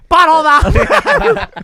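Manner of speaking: high, loud, slow, drawn-out giggly shout; gleeful kids. Retold or imitated. Kids answering the grandparents, gleeful shout. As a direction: blurt it gleefully through laughter. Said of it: "they're like".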